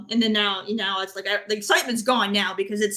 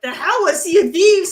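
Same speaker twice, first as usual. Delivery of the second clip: loud, higher incredulous burst; excited outburst; 8 semitones higher, 8 dB louder than usual. Retold or imitated. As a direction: blurt it out like you can't believe it. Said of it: "and I was like".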